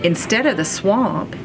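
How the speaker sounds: ordinary delivery, nothing marked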